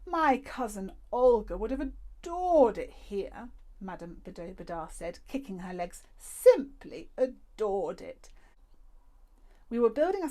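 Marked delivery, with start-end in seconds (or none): 0.0-3.5 higher, louder, slow and rapturous; thrilled old lady. A narrator's reading. The old lady delighting in the make-believe cove, higher and rapturous. Madame Badobadar voiced. 6.2-8.3 slow, louder, drawn-out gush; grand old lady. A narrator's reading. The old lady dreamily praising the cove, slow and grand. Madame Badobadar voiced.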